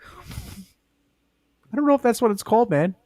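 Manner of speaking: mock high voice. Real, not acted. Joking after odd line.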